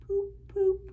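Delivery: ordinary delivery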